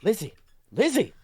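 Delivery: urgent call, rising to a high, loud shout. Acted. Professor to fallen Lizzie, alarmed rising call. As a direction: shout her name to rouse her. Calling to collapsed friend.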